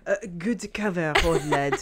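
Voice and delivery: mock French accent. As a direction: play a Frenchman praising the cover. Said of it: "It should have been French".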